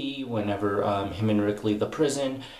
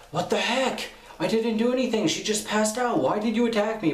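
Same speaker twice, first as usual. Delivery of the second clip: much higher, mock-indignant voice; indignant protest; 12 semitones higher than usual. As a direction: protest your innocence in a high cartoonish voice. Acted protest of kicked character.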